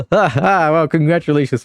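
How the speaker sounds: ordinary delivery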